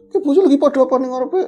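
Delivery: put-on high, loud, fast alarmed old man; alarmed question. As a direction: blurt it out, startled by the crowd. Old Ki Waslam asking in alarm. (bertanya kaget, cepat dan keras)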